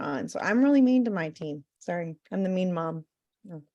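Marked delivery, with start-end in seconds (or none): none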